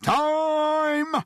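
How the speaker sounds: high, loud, long-held shout; shouted time call. A narrator's reading. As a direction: bellow that time is up. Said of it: "shouted Captain Jimmy".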